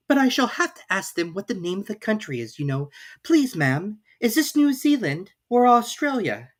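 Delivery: put-on young Alice working it out aloud, voice going up. A narrator's reading. Alice imagines asking.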